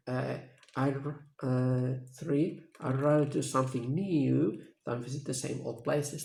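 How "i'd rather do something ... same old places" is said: teacher demo of the stressed "new". Shown as a demonstration. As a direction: lean on the word "new". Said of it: "The stress is on new".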